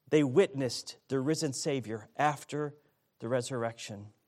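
normal talking voice

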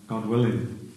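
ordinary talk, nothing marked

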